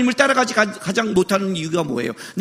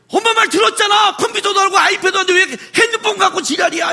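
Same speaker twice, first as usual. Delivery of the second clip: much higher, yelled bratty comeback; bratty child shouting; 9 semitones higher than usual. Retold or imitated. Child shouting back at his mother, much higher. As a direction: throw a tantrum back at mom. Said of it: "이렇게 해요".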